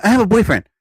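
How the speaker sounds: snappy dismissive brush-off; quick brush-off. Retold or imitated. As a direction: shut him down in one breath. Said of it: "these women quickly turn around".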